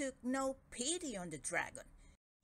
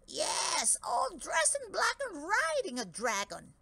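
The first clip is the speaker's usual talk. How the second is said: excited giant; 9 semitones higher, 5 dB louder than usual. Acted. Redbeard answers excitedly.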